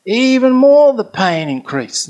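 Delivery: high, loud, drawn-out retold anguish; mounting intensity. Friend recounts pain rising under the monstrance, intense. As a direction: stretch it out, pitch and volume surging.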